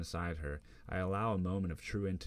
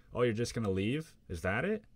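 louder, higher taunt; angry challenge; 5 semitones higher, 5 dB louder than usual. Acted. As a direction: hurl it after her as she packs. She starts to leave crying.

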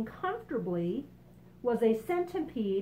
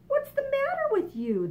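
much higher, louder concerned question; grasshopper asking; 5 dB louder than usual. Acted. Grasshopper asks the frightened boy, high and concerned. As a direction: pitch it way up in surprise. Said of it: "the grasshopper asked".

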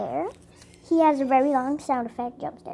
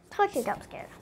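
ordinary talk, nothing marked

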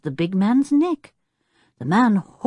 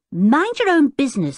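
higher, brusque retort; brusque snub; 9 semitones higher, 4 dB louder than usual. A narrator's reading. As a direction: cut the questioner off. Tinker snubs the inquisitive boy.

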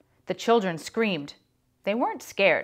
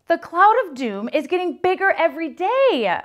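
high, loud alarmed exclamation; exclaiming; 10 semitones higher, 8 dB louder than usual. Acted. Myron warns the class, exclaiming high and loud. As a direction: cry out the doom to the class. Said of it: "Myron exclaimed".

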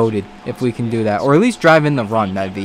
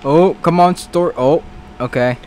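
ordinary talk, nothing marked

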